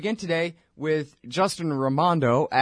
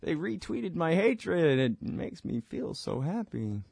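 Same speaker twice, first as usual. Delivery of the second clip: mock gleeful voice; 4 semitones higher, 4 dB quieter, slower than usual. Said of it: "and I go".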